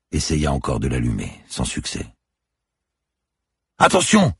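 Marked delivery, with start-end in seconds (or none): 3.8-4.3 high, full-voiced shout; urgent high-pitched yell; yelled warning. A narrator's reading. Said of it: "hurla Erwan".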